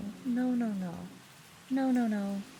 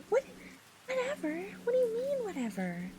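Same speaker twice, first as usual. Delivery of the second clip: indignant disbelief; 12 semitones higher than usual. Real, not acted. Chat says "whatever.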